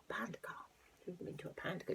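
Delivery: ordinary delivery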